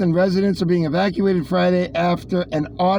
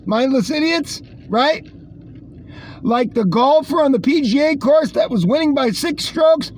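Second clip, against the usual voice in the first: sarcastic rant; 7 semitones higher than usual. Acted. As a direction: scoff at them mockingly.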